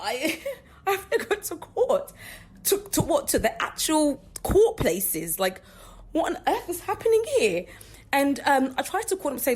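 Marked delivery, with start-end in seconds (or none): 0.0-7.6 disbelief. Retold or imitated. Retelling her shocked reaction.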